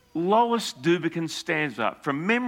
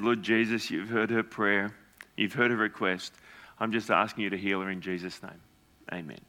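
softer, lower prayer fading to a hush; quiet prayer; 7 semitones lower, 4 dB quieter than usual. Real, not acted. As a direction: pray gently over her. Praying over the woman.

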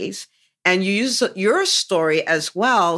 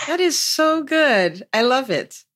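high, drawn-out gush; delighted praise; 7 semitones higher than usual. Real, not acted. Coach loves how guest thought it through, delighted. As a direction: stretch it out with real delight.

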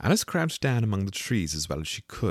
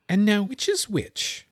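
put-on higher and slow, a girl puzzling; Alice wondering; 14 semitones higher, 4 dB louder, slower than usual. A narrator's reading. Alice asks herself which piece is which, slow and curious. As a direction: ask it slowly, in a child's voice. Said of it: "she said to herself".

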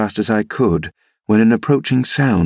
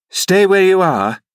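high, raised call through a wall; 11 semitones higher, 3 dB louder than usual. Acted. Nurse answering the young lady beyond the wall, calling out high. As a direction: call it out high to someone out of sight.

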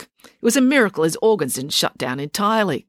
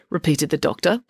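ordinary delivery

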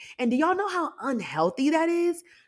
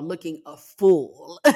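slow, lower, stretched-out jab at oneself; drawn-out self-mockery; 4 semitones lower, much slower than usual. Real, not acted. Podcaster mocking own failed tear-holding, drawn out low. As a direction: drag out the words in mild self-mockery. Mocking herself, then repeats it.

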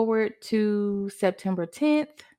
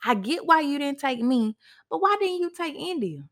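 frantic pleading; 6 semitones higher than usual. Retold or imitated. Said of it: "I'm just praying".

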